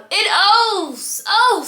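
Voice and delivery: loud flustered breath. Retold or imitated. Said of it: "and loud".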